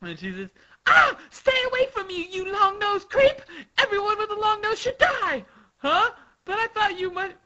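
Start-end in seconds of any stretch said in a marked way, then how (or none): 0.9-5.5 very high, loud shrieking rant; hysterical Sugar. Acted. Sugar to her long-nosed servant, shrieking fury. 5.8-7.4 very high acted confusion; startled character voice. Servant answering Sugar's tirade, high puzzled voice.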